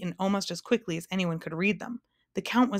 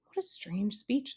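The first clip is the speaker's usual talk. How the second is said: soft, slow, higher musing; puzzled thought; 4 semitones higher, 6 dB quieter, slower than usual. A narrator's reading. Milo wondering at the humbug's speech, puzzled thought. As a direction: think it aloud softly and slowly. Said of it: "thought Milo".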